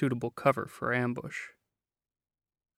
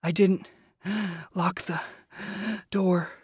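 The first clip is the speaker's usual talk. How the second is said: breathy; 7 semitones higher than usual. A narrator's reading. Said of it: "He still sounded breathy".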